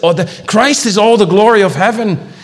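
high, loud sermon voice; impassioned preaching. Real, not acted. Preacher exalting Christ as all the glory of heaven, impassioned. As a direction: proclaim it with full force.

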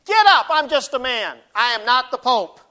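loud, high, emphatic refusal; protesting apostle. Acted. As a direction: push back forcefully at being worshiped. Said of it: "what's Peter saying?".

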